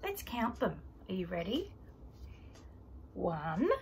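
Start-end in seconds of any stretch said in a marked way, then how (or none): none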